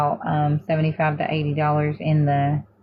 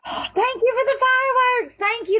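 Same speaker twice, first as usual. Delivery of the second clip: very high, excited squeal of thanks. Real, not acted. Thanking a viewer for fireworks on stream, high excited squeal. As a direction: gush over the gift.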